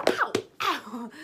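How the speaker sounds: sharp loud pained yelps; sudden ouch, loud and high. Real, not acted. Sudden pain mid-outro.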